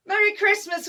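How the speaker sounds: high, loud festive call; shouting back. Acted. Soldiers shouting back to the German line, loud call. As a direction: holler the greeting back together. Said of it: "we all shout back".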